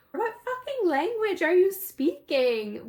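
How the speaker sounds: high, loud, drawn-out mock yell; retold yell. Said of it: "he loses it and yells".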